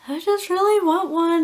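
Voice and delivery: high, breaking voice as she starts to cry; retelling the moment the tears came, pleading; tearful plea. Retold or imitated. To her sister about wanting a baby, high and tearful. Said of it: "I started bawling and crying".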